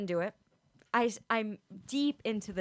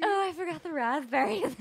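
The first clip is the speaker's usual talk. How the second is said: high laughing airhead voice; ditzy voice; 6 semitones higher, 3 dB louder than usual. Retold or imitated. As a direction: ham up the dim girlfriend. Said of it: "make Sarah sound a little vapid".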